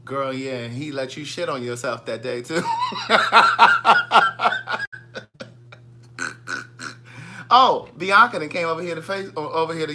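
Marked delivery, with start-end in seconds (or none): 2.7-5.4 squealing high laugh; high laughter. Real, not acted. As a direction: let a shrill laugh burst out. Cracking up at own joke.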